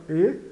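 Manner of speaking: E as a tight "ê", not open "é"; closed E. Shown as a demonstration. Teacher confirming the right vowel, E said closed.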